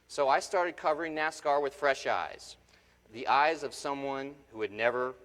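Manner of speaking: loud, driving keynote delivery; a speaker firing up the morning crowd; motivational speech at full force. Acted. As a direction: project it to the back of the hall.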